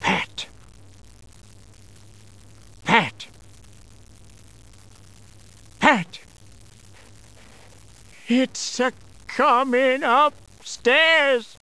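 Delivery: slow, rising, ominous steps building to a high moan; creeping dread. Acted. Narrator creeping the ghost up the stairs, slow and building. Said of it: "he hear".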